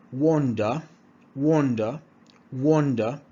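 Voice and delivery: normal talking voice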